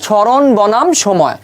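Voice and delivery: very slow, spaced-out term; model reading. Shown as a demonstration. Teacher answers own question on naming the graph, slow model reading. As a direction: draw out each word for the class. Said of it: "আমি উচ্চারণ করব কি বলতো".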